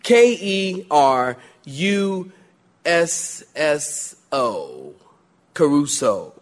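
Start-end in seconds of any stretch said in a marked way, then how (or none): 0.0-4.9 slow letter-by-letter spelling. Shown as a demonstration. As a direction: spell each letter slowly for note-takers. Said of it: "taking notes?".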